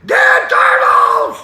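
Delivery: drawn-out high mock shriek; hysterical scream. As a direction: scream it slowly in horrified panic. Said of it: "He said".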